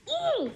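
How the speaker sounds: very high, drawn-in squeal of annoyance; acted high-pitched pent-up ooh; frustrated ooh. Reacting to his dismissive call.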